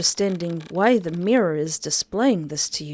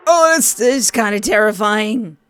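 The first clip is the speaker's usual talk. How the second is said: nervous fright; 3 semitones higher, 6 dB louder than usual. Real, not acted. Reacting to the creature.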